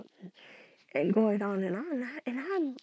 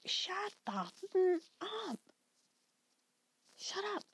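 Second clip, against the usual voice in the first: hushed mock scream; 10 semitones higher than usual. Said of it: "just screaming".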